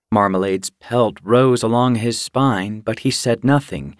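ordinary delivery, nothing marked

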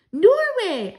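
put-on high, loud child's shout; excited cry. A narrator's reading. Grandchild guessing the country for Grandmama, excited cry. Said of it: "I cried".

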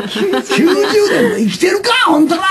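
high-pitched comeback in a mock fight; quarrelling. Answering back in an Osaka fight, mock angry. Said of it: "とか言う喧嘩が多いんですよ".

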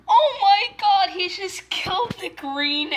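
loud, high cry of pretend shock; dramatic play alarm; mock horror. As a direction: exclaim in hammed-up horror. Egg bat killed in play.

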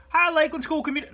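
a Southern twang tried out, then dropped; Southern accent. Shown as a demonstration. Southern accent tried for the school greeting. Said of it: "No, I don't do a Southern accent".

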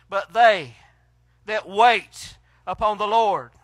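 slow, loud, drawn-out verse; emphatic scripture reading. Acted. Preacher reading his favorite verse to the church, slow and loud. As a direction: lean on each phrase like a promise. Reads favourite verse slowly.

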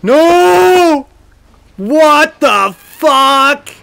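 loud, stretched out disbelief; streamer's real shocked outburst; shocked yell. Real, not acted. Streamer reacts to the murder.

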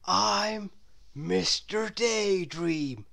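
slow, dreamy character voice; unhurried whimsical figure; Mister Daydream voice. A narrator's reading. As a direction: introduce himself slowly and gently. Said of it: "said the figure".

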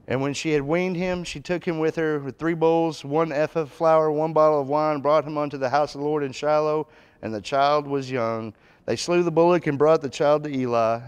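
loud, high, preaching read-aloud; fervent scripture reading. Acted. Preacher reads Hannah's vow aloud, loud and fervent. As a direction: proclaim the verse to the congregation.

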